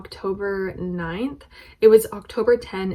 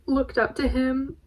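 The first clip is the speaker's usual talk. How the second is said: real tears, higher voice with halting pauses; tearful; 4 semitones higher than usual. Real, not acted. Fan telling viewers what meeting an idol meant, tearful. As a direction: push on through tears, pitched up and choked. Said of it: "I really didn't want to cry".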